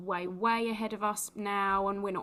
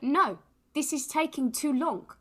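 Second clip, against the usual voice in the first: badly done South African accent, pitched higher; raised voice in a wobbly South African impression; attempted South African accent; 6 semitones higher, 4 dB louder than usual. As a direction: have a poor go at South African. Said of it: "It's a South African accent".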